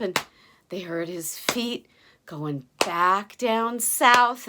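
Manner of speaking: plain reading voice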